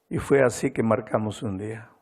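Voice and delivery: usual speaking voice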